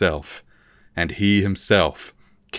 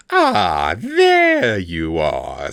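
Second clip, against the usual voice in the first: very slow, louder oily greeting; mock welcome; 5 dB louder, much slower than usual. A narrator's reading. Vilnix greets the bound prisoner, slow oily welcome. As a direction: draw it out with false charm.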